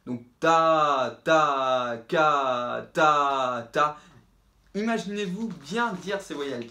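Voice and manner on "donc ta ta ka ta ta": "ta" with a tiny t and a long open a, said louder; loud drawn-out vowels on each syllable; big vowels. Shown as a demonstration. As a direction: stretch the vowel of each "ta, ka" after a tiny consonant. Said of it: "toutes vos voyelles derrière qu'il faut bien prononcer".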